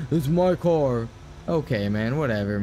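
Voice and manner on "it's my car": drawn-out mock of the driver; mocking slow retort. Imitating the driver's smug reply, slow. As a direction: stretch it out, mockingly. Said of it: "That's why I'm like".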